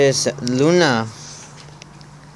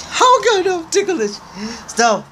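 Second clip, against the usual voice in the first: being tickled. Real, not acted. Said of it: "you're tickling me".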